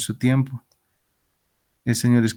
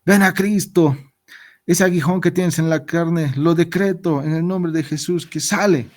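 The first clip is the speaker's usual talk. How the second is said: raised mock proclamation to the apostle Paul; mock healing preacher; 5 semitones higher than usual. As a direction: play a healer commanding the illness out. Imagined preacher decreeing healing to Paul.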